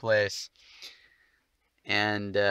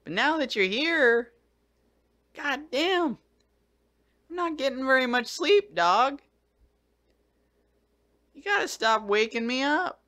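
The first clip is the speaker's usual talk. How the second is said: high sing-song complaint to the dog; talking to the dog; 13 semitones higher than usual. Real, not acted. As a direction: whine at the dog in a high pet voice.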